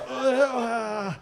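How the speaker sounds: high pained mock groan; cramp groan. Comic imitates a stomach cramp, groaning. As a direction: let the gut cramp squeeze it out. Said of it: "stomach cramp goes".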